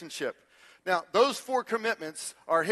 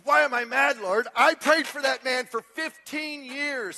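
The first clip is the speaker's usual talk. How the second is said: high, drawn-out mock outrage; acting out an exasperated wife; indignant protest; 6 semitones higher, 5 dB louder than usual. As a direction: protest loudly, stretching the grievance. Wife's angry answer to God, acted.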